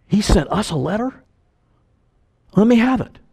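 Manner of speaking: high, eager amazement at a letter from Jesus; excited pastor. Retold or imitated. Pastor amazed at receiving the apostle's letter, high and eager. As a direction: gasp it out, thrilled and lifted.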